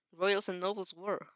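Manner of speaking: ordinary delivery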